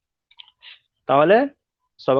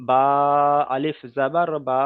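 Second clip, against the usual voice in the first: আলিফ জাবার বা recited slowly, বা held long; slow recitation drill; slower than usual. Shown as a demonstration. Teacher reciting the Qaida lesson slowly. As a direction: chant each syllable slowly. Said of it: "কেমন করে পড়বো".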